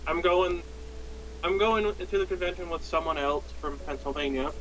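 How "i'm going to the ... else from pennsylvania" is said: ordinary delivery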